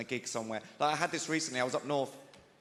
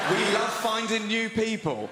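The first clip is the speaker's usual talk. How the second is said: slow, loud, high and emphatic; drawn-out innuendo-laden replay; suggestive repeat; 7 semitones higher, 6 dB louder, slower than usual. Retold or imitated. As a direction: spell it out word by word in disbelief. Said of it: "this couple approached me".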